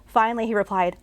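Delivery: plain reading voice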